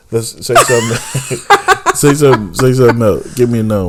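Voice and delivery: loud laughing push for another one; laughing insistence. Real, not acted. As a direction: egg her on, loud and giddy. Egging on his wife, laughing.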